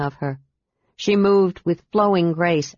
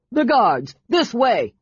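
high-pitched calling out; urgent call; 5 semitones higher, slower than usual. A narrator's reading. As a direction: call a warning to the group. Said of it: "called Marcus".

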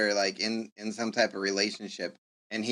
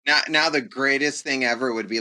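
ordinary talk

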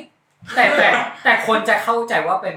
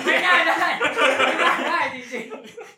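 laughing; 8 semitones higher, slower than usual. Real, not acted. Testing saying the word aloud.

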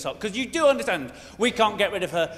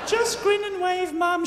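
prim Queen voice; 9 semitones higher, slower than usual. Retold or imitated. Queen telling her mother to behave.

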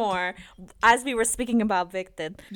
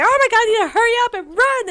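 panicked rush; 11 semitones higher, faster than usual. Retold or imitated. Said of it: "I was like".

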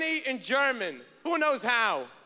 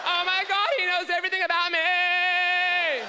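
mock swooning; 5 semitones higher than usual. [name] amazed.

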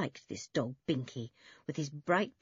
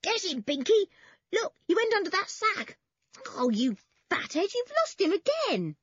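high-pitched urging shouts; excited yelling; 4 dB louder than usual. A narrator's reading. Toby urging his collie after a rat, excited yelling. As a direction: egg the dog on excitedly. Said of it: "More yells".

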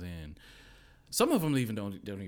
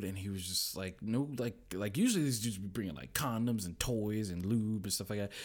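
usual speaking voice

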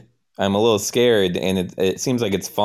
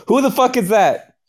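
alarmed shout; 4 dB louder than usual. Acted. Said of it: "I go".

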